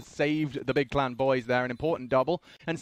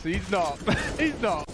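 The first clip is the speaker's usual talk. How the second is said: higher and higher, laughing; excited; 5 semitones higher, slower than usual. Real, not acted. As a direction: build the excitement on each repeat. Repeated louder higher.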